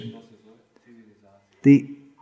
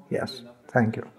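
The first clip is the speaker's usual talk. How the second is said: hushed polite reply; 4 semitones lower, 12 dB quieter than usual. Retold or imitated. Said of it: "That's the modern demon".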